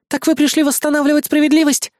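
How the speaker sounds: high, thrilled woman's voice; admiring. A narrator's reading. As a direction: ask it in breathless admiration. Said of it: "восхищённо произнесла Раиса".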